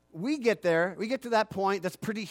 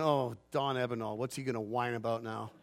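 annoyed groan; 8 semitones lower, 6 dB quieter than usual. Retold or imitated. Mocking annoyed caller.

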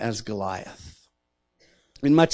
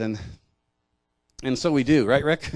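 usual speaking voice

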